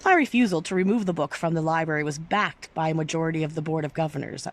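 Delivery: usual speaking voice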